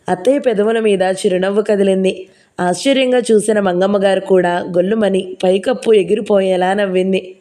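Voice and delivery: usual speaking voice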